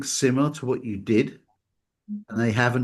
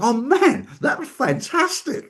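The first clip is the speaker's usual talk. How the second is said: much higher, slow and gushing; mock gushing; slower than usual. The therapist gushing over his own work, mock rapture. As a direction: lay the praise on thick. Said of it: "And you're like".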